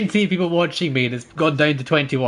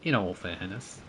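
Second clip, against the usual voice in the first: lower, softer Welsh attempt; imitated Welsh accent; 4 semitones lower, 7 dB quieter than usual. Shown as a demonstration. Gamer retrying friend's catchphrase, soft Welsh accent. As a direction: try the Welsh lilt low and soft. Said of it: "it's difficult to get that accent".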